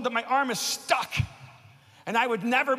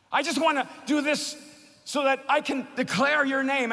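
preaching climax; 5 semitones higher than usual. Acted. Preacher telling the congregation of his healing, raised and rising. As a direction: build the testimony to a peak.

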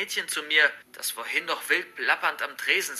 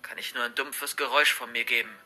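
plain reading voice

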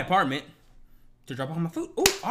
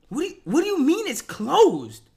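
loud, higher disbelieving protest; indignant outburst; 9 semitones higher, 7 dB louder than usual. Retold or imitated. Protesting that the restaurant is closed, loud and indignant. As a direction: push back hard in disbelief.

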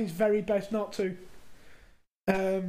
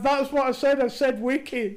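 louder, higher, laughing mock insistence; retelling a colleague's insistence, louder and a bit higher; insisting; 5 semitones higher, 6 dB louder than usual. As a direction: play the stubborn coworker, half laughing. Coworker insisting, retold.

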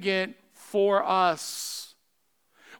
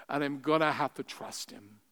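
normal talking voice

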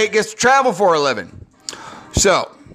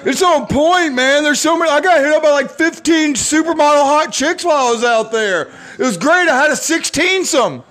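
roommate impression. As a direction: brag like a laid-back surfer roommate. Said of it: "That's how my roommate talks".

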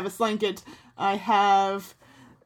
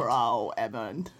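put-on German accent; 10 semitones lower, 5 dB quieter, much slower than usual. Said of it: "It's my German accent".

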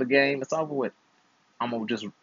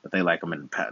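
ordinary delivery

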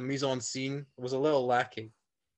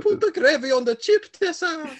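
put-on Italian accent; 9 dB louder than usual. Italian Quebecer calls for Tessa.

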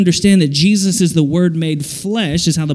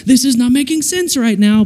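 confused complaint; 7 semitones higher than usual. Acted. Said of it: "you're just like".

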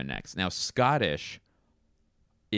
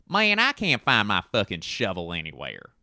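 put-on Southern accent; 4 dB louder than usual. Working through accents poorly, Southern twang.

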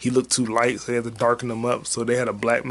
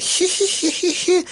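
high creepy villain giggle. Retold or imitated. Creepy character giggling in the movie, high giggle. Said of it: "Tobey Maguire just laughing like".

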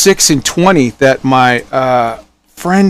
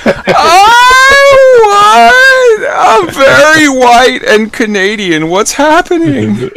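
panicked friend; 13 semitones higher, much slower than usual. Retold or imitated. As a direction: squeal in mock confusion. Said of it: "He was just like".

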